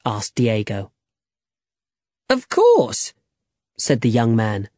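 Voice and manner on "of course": very high, breezy certainty; cocky assent. A narrator's reading. Young man assures Diego he can fence, cocky. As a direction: toss it off high and confident.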